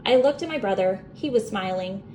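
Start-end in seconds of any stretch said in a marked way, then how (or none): none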